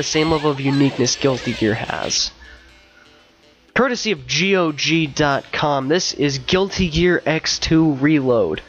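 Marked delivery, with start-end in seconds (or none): none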